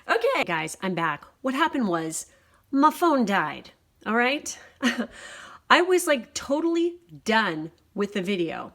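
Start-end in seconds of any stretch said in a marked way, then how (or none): 1.4-8.7 upset, exasperated. Real, not acted. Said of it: "So I am so upset".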